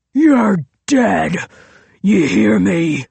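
loud, furious threat; screaming in rage. A narrator's reading. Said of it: "he screamed".

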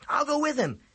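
high, loud quick offer; eager offer. A narrator's reading. Boy eagerly offers to leave class with his friend. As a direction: jump at the chance to leave. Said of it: "Ezzy offered quickly".

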